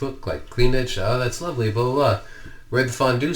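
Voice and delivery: a hint of the chirpy wife; wife's voice. Husband voicing wife's return home.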